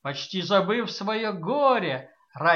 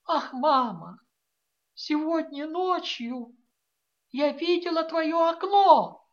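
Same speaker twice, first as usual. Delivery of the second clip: barely getting it out; 10 semitones higher, 5 dB louder than usual. A narrator's reading. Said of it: "Ивета еле выговорила".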